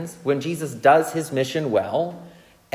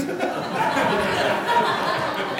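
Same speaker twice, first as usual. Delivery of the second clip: laugh. Real, not acted. Laughing at his own story.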